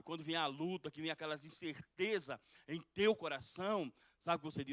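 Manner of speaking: loud pulpit preaching, driving each phrase; a fired-up sermon, pressing the point; preaching at full force. Acted. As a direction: preach it out to the congregation.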